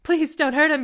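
high, loud pleading; desperate cry. A narrator's reading. Liz begging robbers to spare Niall, desperate cry. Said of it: "you cried, and you hated how weak and desperate".

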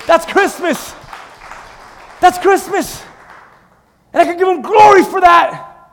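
ordinary talk

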